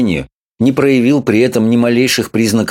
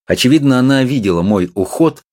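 ordinary delivery

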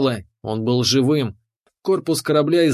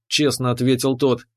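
ordinary delivery, nothing marked

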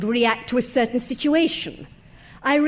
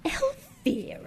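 high, slow, incredulous cry of the name; shocked disbelief; 12 semitones higher, 6 dB quieter, slower than usual. Acted. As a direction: repeat the name as if you can't believe it. Wife hears the dead wife's name.

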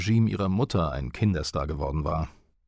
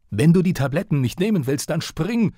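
retold yell; 8 semitones higher, 4 dB louder than usual. Father yells after his son fleeing to the balcony, furious yell. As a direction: read out as a yell, much higher and louder. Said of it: "brüllte er mir hinterher".

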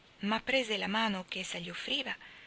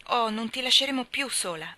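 exclaiming; 4 semitones higher, 6 dB louder than usual. A narrator's reading. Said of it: "esclamò Lori".

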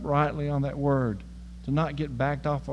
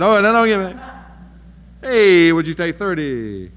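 louder, higher wheedling; Abraham haggling; 4 semitones higher, 12 dB louder than usual. Retold or imitated. Abraham haggles God down to thirty, loud wheedling. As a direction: sweet-talk the price down. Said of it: "And he said".

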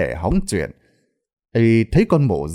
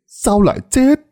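shocked cry; 12 semitones higher, 4 dB louder than usual. Acted. Village elder, stunned by the death news, cries out in alarm. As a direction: repeat the question much higher and louder in alarm. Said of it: "hốt hoảng".

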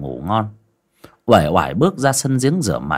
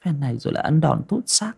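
grimacing; 6 semitones higher, 4 dB quieter, faster than usual. Acted. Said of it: "Con Ngân nhăn nhó".

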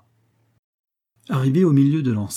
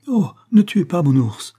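higher, louder plea, crying out; pleading cry; 7 semitones higher, 6 dB louder than usual. A narrator's reading. The young native girl begs soldiers to spare her bear, crying out. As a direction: beg them to spare the bear. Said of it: "s'écria la jeune indigène".

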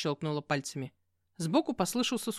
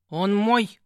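loud possessive growl; 3 semitones higher, 9 dB louder than usual. A narrator's reading. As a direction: snarl it like a claim. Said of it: "рыкнула на пса". (рычание, громко)

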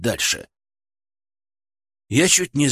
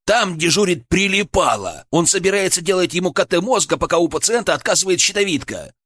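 higher, heated protest; unrestrained outburst; 5 semitones higher than usual. A narrator's reading. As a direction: argue urgently with the boss, pitched up. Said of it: "не сдержался я". (горячо, не сдерживаясь)

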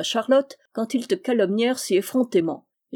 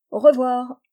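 mock farewell; 4 semitones higher, 6 dB louder than usual. A narrator's reading. Yann smashes the teapot.

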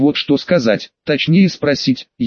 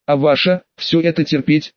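plain reading voice

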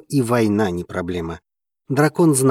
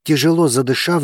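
usual speaking voice

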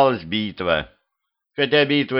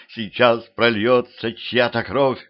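plain reading voice

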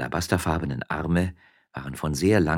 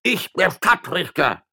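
high, loud, slow insistence; indignant shout; 13 semitones higher, 7 dB louder than usual. Acted. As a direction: hammer home who you are. Judge shouting his rank.

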